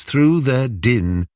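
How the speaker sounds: plain reading voice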